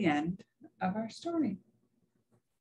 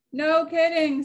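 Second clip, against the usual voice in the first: high, loud exclamation; emphatic agreement; 10 semitones higher, 6 dB louder than usual. A narrator's reading. Dot agrees with her alarmed friends, emphatic.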